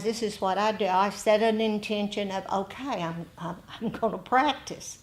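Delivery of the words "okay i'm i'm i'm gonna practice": ordinary talk, nothing marked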